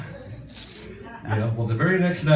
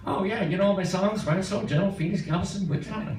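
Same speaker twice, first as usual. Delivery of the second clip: high-pitched imitation of an easygoing singer; 9 semitones higher, 4 dB quieter than usual. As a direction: mimic him in a high, light voice. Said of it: "And he goes".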